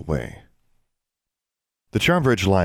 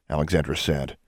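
ordinary delivery

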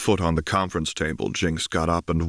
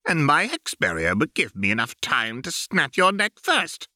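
high, amused villain voice; a gleeful sneering baron, pitched high; 9 semitones higher than usual. Acted. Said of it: "his voice pitched high with amusement".

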